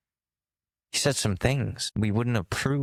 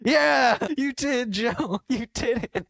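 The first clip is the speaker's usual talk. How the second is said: cheering crowd; 13 semitones higher, slower than usual. Retold or imitated.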